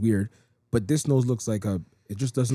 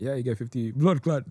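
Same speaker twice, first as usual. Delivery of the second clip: put-on Jamaican accent; 2 semitones lower, 4 dB quieter than usual. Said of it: "I really tried to do a Jamaican accent".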